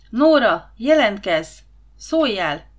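hangos, magas kiáltás a sötétben; kiáltsd el kétségbeesetten a nevét. A narrator's reading. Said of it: "kiáltotta". (kétségbeesett, hangos kiáltás)

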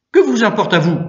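loud, high quarrelling voice; angry retort. A narrator's reading. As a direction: throw the challenge back, loudly. Sacristan snaps back in quarrel.

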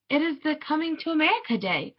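put-on high-pitched child's reply; child answering. A narrator's reading. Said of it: "I said".

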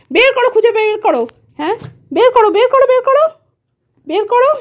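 high, sing-song talk to a pet. Real, not acted. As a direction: coax the pet in a high playful voice. Calling pets playfully. (উঁচু গলায় পোষ্যের সঙ্গে আদুরে কথা)

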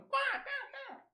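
very high, soft laughing quacks; mock parrot quacking; parrot quack imitation. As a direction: giggle out tiny parrot quacks. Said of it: "my parrots fucking going".

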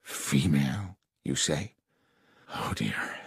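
low, hushed dismay; soft, halting unease, trailing almost to nothing; a little shaken. Acted. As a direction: let the voice sink, taken aback. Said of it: "appeared a little shaken".

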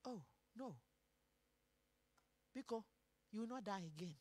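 very low, very soft, spaced out; hushed divine reply. Acted. Preacher voicing God answering the king, hushed and low. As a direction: let God answer almost under the breath.

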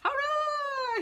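high, loud triumphant cheer; shouted hurrah. A narrator's reading. Pig cheering as he leaps off the crocodile, high and loud. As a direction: shout it out in victory. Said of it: "he shouted".

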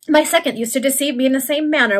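usual speaking voice

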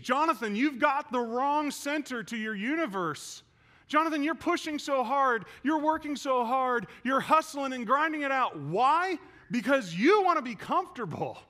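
loud, high, hammering admonishment; father's stern rebuke. Retold or imitated. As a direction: confront your son, pounding each phrase. Acts out dad's forceful rebuke.

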